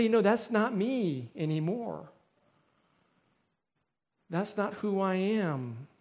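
slow, deliberate declaration; measured, drawn-out refusal; resolute refusal. Acted. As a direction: state it slowly, letting it settle. Voicing firm refusal of temptation.

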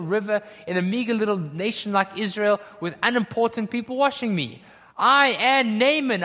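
haughty, emphatic indignation; Naaman. Acted. Proud commander scorning a meager little river, haughty and indignant. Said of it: "And then he says".

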